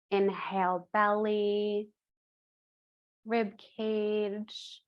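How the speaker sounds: slow, spaced-out breath cues; guided breathing. Acted. Yoga teacher leading the three-part breath, measured guided breathing voice. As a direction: count them through the breath, leaving long pauses.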